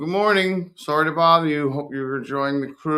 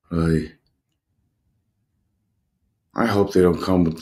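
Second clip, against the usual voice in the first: ordinary delivery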